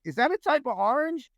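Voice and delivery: high, goofy puzzled question; mock confusion. To his Ranger buddies, joking, high mock-puzzled voice.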